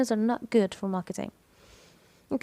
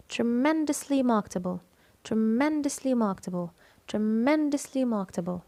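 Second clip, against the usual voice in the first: "tremendously" peaking on "men"; stress on MEN. Shown as a demonstration. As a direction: lean on the second syllable, "men", of "tremendously". (التشديد على "men" في "tremendously")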